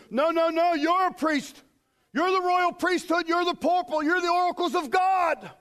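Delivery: high, insistent preacher's build; forceful preaching. Real, not acted. Preacher correcting the congregation about their calling, emphatic high preaching. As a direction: drive each phrase home, pitched high.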